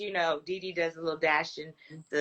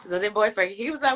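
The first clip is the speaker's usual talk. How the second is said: ordinary delivery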